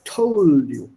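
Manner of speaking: Told with a crisp aspirated t at the start. Shown as a demonstration. Teacher demonstrating the t sound in Told. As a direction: puff the t in Told. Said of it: "Use that t sound there".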